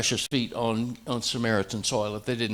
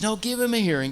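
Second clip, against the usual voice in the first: higher, insistent appeal; urging plea; 9 semitones higher, faster than usual. Retold or imitated. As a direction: push them to listen, pitch up. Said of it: "Nicodemus says".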